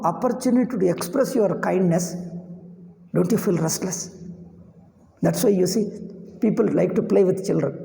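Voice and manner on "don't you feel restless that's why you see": normal talking voice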